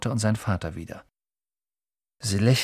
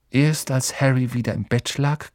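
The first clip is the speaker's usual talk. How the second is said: usual speaking voice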